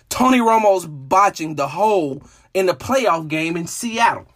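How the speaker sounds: normal talking voice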